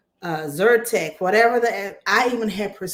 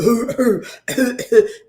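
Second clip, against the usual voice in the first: demo deep cough; 4 semitones higher, 8 dB louder than usual. Shown as a demonstration. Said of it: "your cough sounds like".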